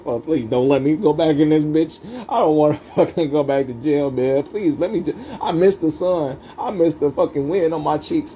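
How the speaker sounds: high, pleading prayer from inside jail. Retold or imitated. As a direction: beg God to not send you back. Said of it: "I start praying".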